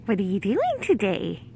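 ordinary delivery

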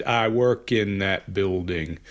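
slowed, deliberate imitated answer; a hint of smug slow cadence; slow smug imitation. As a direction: lay it out slowly as if explaining. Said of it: "his smugness comes out".

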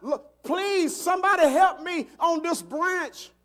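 high, loud acted plea; hollering up from a branch; yelling for help. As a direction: beg loudly for rescue. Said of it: "he yells out".

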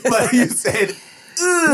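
high, slow, laughing mock French accent; fake French accent. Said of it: "Fake French".

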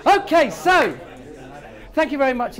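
loud, high call over the room. Real, not acted. Trainer calling the chattering room back, loud. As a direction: pull the whole room back to attention.

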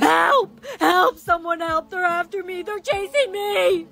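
frightened calls rising in pitch; panicked cry for help. Acted. Victim being chased calls out, panicked cry. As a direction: cry out for help, drawing out the end.

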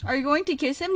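ordinary talk

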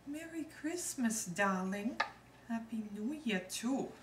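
high-pitched, odd made-up accent; Lucy Ricardo accent. Shown as a demonstration. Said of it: "the odd accent that somebody told me Lucy Ricardo made up".

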